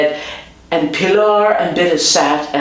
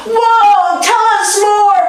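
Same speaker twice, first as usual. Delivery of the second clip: much higher and louder, bursting with delight; thrilled kids; 4 dB louder, slower than usual. Retold or imitated. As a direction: beg for more of the story.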